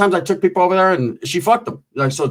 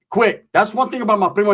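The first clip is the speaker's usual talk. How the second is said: ordinary talk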